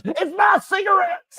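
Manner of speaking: put-on outraged old-lady squawk; angry grandma. Imitating grandma finding her cigarettes in the costume. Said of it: "she goes".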